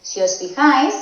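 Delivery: ordinary talk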